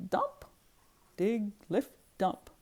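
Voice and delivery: usual speaking voice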